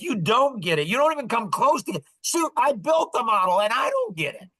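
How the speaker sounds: mock exasperation at people who claim to understand; irritated. Venting to a friend about people who claim they understand, irritated. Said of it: "it's so irritating".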